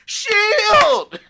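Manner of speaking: high, loud mock cheer of excited fans; mock crowd cheer. Fans at the camp out cheering for the Shield, high mock shout. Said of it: "everybody was like".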